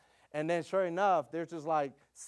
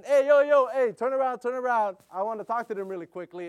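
loud, much higher call from behind; yelling to sons; 6 semitones higher, 9 dB louder than usual. Retold or imitated. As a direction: shout ahead to the boys to turn around. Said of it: "So I'm yelling at my boys".

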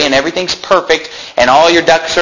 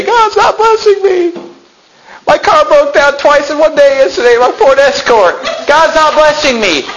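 bitter whiny pastor; 9 semitones higher than usual. Pretend. Preacher mocking a bitter pastor complaining about his broken car, high and whiny. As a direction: whine like a pastor sure God has abandoned him. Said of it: "Don't get bitter and say".

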